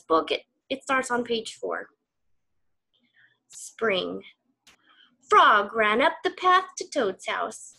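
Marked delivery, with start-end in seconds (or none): 3.5-4.2 whisper. Acted. Reading the story title.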